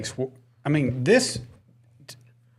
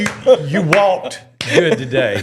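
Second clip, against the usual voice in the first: slow, higher, hammed-up praise for a little kid; mock dad praise; 5 semitones higher, 4 dB louder, much slower than usual. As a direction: overdo the praise like a proud dad, laughing. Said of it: "mock praise to son".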